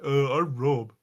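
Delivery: a hint of a British accent, introducing himself; lightly imitated British accent. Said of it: "Rob has a British accent".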